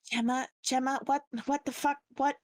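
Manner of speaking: wounded fighter panicking at the pain; panicked pain. Acted. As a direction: cry out confused and hurting.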